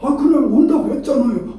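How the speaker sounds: high-pitched, insistent complaint; mock reproach. Preacher acts reminding no-show of promise, high-pitched reproach. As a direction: protest as if holding someone to their promise. Acted reproach in retold argument.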